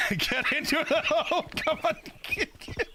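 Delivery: threading a needle. Real, not acted. Dragging corpse into grave hole.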